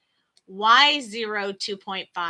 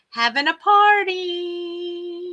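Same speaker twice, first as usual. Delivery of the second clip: playful singsong; 8 semitones higher, 3 dB louder, slower than usual. Acted. Cute dragon having a party.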